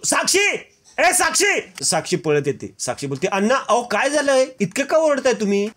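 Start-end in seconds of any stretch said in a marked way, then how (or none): 0.0-1.8 calling out angrily. Acted. Mahipat finds the safe empty and yells for Sakshi. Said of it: "तो साक्षीला आवाज देतो". 3.3-5.7 alarmed. Acted. Sakshi runs in alarmed.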